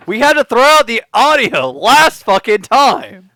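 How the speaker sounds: loud, high-pitched ribbing complaint; exasperated rant. Real, not acted. Host teasing John about the ruined audio, loud exasperation.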